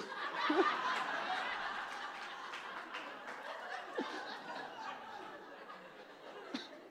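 long, high, voiceless wheeze of laughter; real laughing fit, airy and high; high breathless laugh. Real, not acted.

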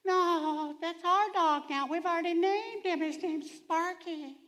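put-on high child voice begging to keep the dog; pleading kid. Child begging dad to keep the dog, high pleading voice. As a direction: plead sweetly for Sparky.

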